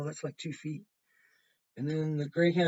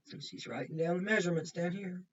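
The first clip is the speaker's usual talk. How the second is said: ordinary delivery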